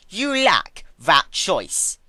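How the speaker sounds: slow, high and loud icy verdict; cold Twilight. Acted. Twilight informing the crying princess, icy and loud. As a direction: deny her flatly, from above. Twilight coldly denies her.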